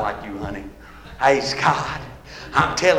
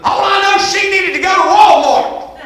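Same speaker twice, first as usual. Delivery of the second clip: high, loud, stretched-out hollering; full-force preaching shout; 9 semitones higher, 5 dB louder than usual. Real, not acted. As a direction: belt each phrase to the back pew.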